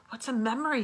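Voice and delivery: put-on high, eager boy's question; lightly voiced curious boy. A narrator's reading. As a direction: ask like a curious child.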